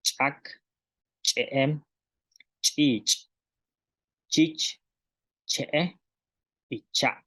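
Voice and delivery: solo las palabras, "Chak", "chich", claras y separadas; pronunciación maya. Shown as a demonstration. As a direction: marcar la "ch" en cada palabra. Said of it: "solamente las palabras del maya". (maestra repite las palabras mayas sin traducción)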